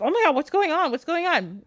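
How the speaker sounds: high-pitched mock panic, easing down; freaking out. Vasilia when all the lights go out, acted panic. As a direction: jump high, as if alarmed. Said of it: "Vasilia's freaking out".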